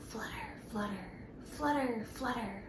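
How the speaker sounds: soft airy whispery flutter; hushed flutter. Acted. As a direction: breathe it softly like wings. Butterfly sound, hushed.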